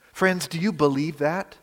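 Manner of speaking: ordinary talk, nothing marked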